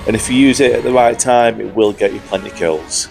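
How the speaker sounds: usual speaking voice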